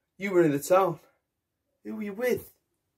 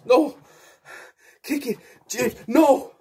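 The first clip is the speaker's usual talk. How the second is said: high, loud panicked no; terrified cry; 6 semitones higher, 3 dB louder, slower than usual. Acted. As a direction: cry out as the attack starts. Victim screaming during attack.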